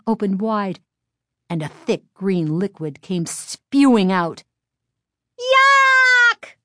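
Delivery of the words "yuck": high, loud shriek of revulsion; screamed disgust. A narrator's reading. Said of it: "someone screamed".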